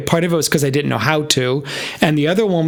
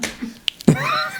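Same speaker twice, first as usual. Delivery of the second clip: high laugh; 3 dB quieter than usual. Real, not acted. Laughing at own joke.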